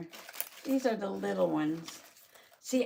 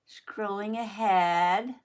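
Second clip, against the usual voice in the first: slow, louder, stretched with a rising end; drawn-out announcement; 5 dB louder, much slower than usual. Acted. Telling viewers the video jumps ahead, slow and stretched.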